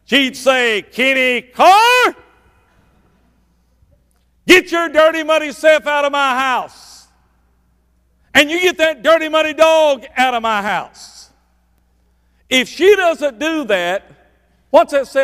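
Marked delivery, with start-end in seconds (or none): 0.8-11.3 loud, high scolding shout; a wife chasing her husband and dog out of the house; wife crying out. Retold or imitated. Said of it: "cry out and say".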